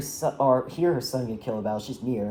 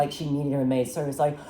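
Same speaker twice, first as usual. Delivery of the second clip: usual speaking voice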